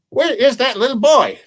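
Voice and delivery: high, indignant granny voice; fretting old woman. Acted. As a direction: demand it high as an impatient grandma. Old woman wonders where boy is.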